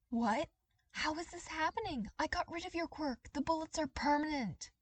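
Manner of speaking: high, rising panic in disbelief; a villain realizing his trick failed; shocked disbelief. Acted. Villain shocked his power fails.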